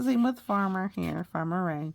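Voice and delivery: plain reading voice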